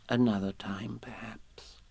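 whispered comfort; softly consoling a friend. A narrator's reading. Piglet comforting Pooh.

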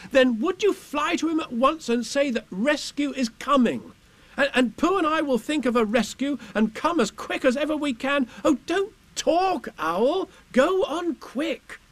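put-on high-pitched, insistent boy; Christopher Robin, urgent. A narrator's reading. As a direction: cut in and send him off urgently. Boy interrupts, urges Owl on.

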